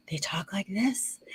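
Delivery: very soft, slow, near-whispered calm; calm kindergarten-teacher voice. Shown as a demonstration. Imitating people who work with kindergartners, soft calm voice. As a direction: hush it gently, as if for small children. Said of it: "calm voice".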